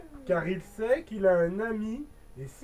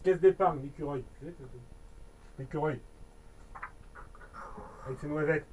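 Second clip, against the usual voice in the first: mock squirrel aside; 8 semitones lower, 7 dB quieter, faster than usual. Joke aside on the squirrel logo.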